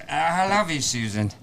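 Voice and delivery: mock English actor, starting high and dropping; Dudley Moore. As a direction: put on a comic English accent. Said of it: "So it's only Dudley Moore".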